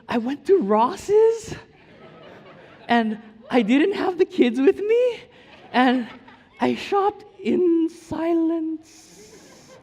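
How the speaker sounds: very high, loud, slow laughing wife voice; imitating his wife laughing. Husband mimics wife's delight at shopping alone. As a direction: squeal it out slowly, laughing with joy. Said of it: "She goes".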